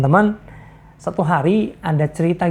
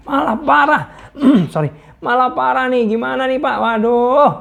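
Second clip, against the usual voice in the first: higher, louder complaint broken by laughter; laughing grumble; 7 semitones higher, 3 dB louder than usual. Retold or imitated. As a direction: grumble while cracking up. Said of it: "Ngomel-ngomel kan". (mengomel sambil tertawa)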